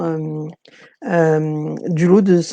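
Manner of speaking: normal talking voice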